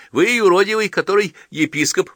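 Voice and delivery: put-on high, loud child's voice; forward little girl. A narrator's reading. Girl asks the old bishop who he is, forward.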